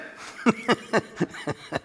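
breathy laugh at own joke. Real, not acted. Preacher laughing at his own T-shirt joke.

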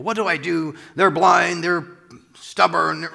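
loud, high-pitched frustrated griping; mock exasperation. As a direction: throw up a loud, hammed-up complaint. Acts out frustration with unbelievers.

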